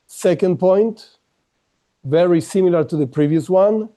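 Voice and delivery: normal talking voice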